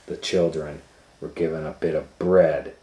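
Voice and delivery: ordinary delivery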